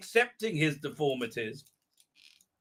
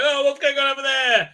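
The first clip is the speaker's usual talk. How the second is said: mock surprise; 8 semitones higher, 6 dB louder than usual. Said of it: "they're like".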